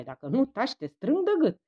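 furious threat to Nae. A narrator's reading. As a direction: threaten him through the anger. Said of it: "Ștefan se răsuci furios spre Nae".

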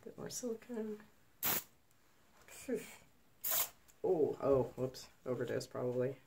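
usual speaking voice

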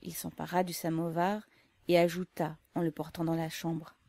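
plain reading voice

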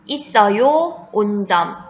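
spelling test read; dictating. Shown as a demonstration. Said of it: "문제는 딱 두 번 들려줄 테니까 잘 듣고".

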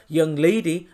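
low, mock stern scolding elder; low mock-patronising aside; patronising young lady. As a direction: drop down like a condescending uncle. Mocking his patronising tone.

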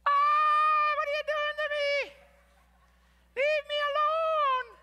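very high, loud comic shriek; hammed-up scream as clay in the kiln. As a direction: squeal in mock agony, stretching the words. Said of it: "The clay is screaming".

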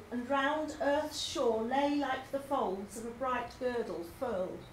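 slow, drawn-out reading that fades away; slow poem reading. Shown as a demonstration. Teacher reading Dover Beach to the class, slow and fading. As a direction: let the words retreat like the tide. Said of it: "It's also slow and drawn out".

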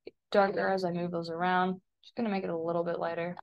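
ordinary delivery

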